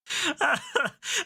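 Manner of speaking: high-pitched laugh at his own tale. Real, not acted. Laughing after retelling his exit-door telling-off, amused.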